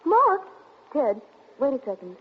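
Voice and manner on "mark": high, loud, startled question. Acted. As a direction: blurt the name out in alarm. Startled to hear who has arrived.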